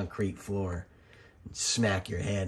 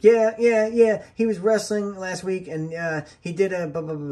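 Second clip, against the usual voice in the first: much higher, much louder eager rattling off; excited mock Gabe; 10 semitones higher, 7 dB louder than usual. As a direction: gush it out like you can't wait to tell. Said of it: "And Gabe's like".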